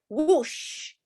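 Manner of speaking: a high, swept "Whoosh"; exclaimed whoosh. Shown as a demonstration. Teacher voicing comic-book sound words for the class. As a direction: say "Whoosh" like something rushing past. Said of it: "these are loud sounds".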